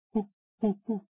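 chimp hoots. Acted. Chimp sounds mid sentence.

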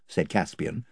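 usual speaking voice

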